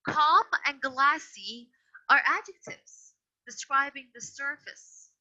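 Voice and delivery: "Calm and glassy" drawn out slowly and firmly; slow down and lean on "Calm and glassy"; slow emphasis. Shown as a demonstration. Teacher slowly stressing "Calm and glassy" for the class. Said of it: "teacher explains adjectives".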